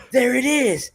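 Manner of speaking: high, loud mock coach's cry; Castillo. Co-host mimicking the coach celebrating a jab, high excited yell. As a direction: call it out triumphantly, imitating an excited cornerman. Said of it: "and he goes".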